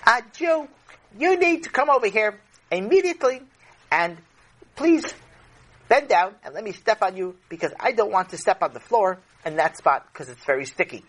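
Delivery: put-on high, bossy little girl voice. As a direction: order him around like a spoiled nine-year-old. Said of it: "a girl who was nine".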